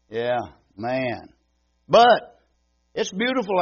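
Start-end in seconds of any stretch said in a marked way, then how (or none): 1.9-3.6 sudden burst. Real, not acted. Sudden burst mid remark.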